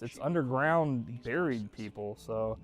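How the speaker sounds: usual speaking voice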